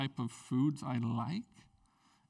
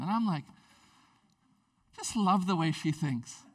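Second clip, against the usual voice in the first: ordinary talk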